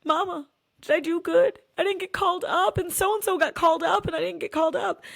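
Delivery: put-on high little girl's voice, whiny. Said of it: "she's like".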